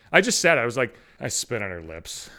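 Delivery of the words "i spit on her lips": low, soft, slow admission; sheepish confession. Retold or imitated. Owning up to his buddy about spitting, sheepish. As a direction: drop your voice and own up reluctantly.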